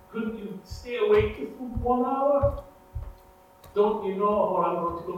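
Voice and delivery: Jesus rebuking sleeping disciples, frustrated; frustrated rebuke. Retold or imitated. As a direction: scold the sleeping friends, pained. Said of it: "you can hear the frustration in his voice".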